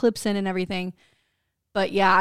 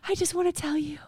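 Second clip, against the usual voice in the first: high, breathy-voiced imitation of mom; breathless mom; 8 semitones higher, 6 dB quieter than usual. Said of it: "This is how my mom talks".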